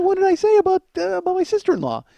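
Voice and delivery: very high, put-upon squeal of protest; defensive disbelief. Retold or imitated. Son asks mom what he said, high-pitched defensive protest. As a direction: imitate a baffled, defensive son. Acts defensive reply to mother.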